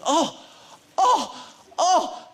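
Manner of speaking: high, loud, mounting gasps of "oh"; excited old fan. Retold or imitated. Actor imitating a little old man spotting the filming, excited. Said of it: "he starts getting very excited".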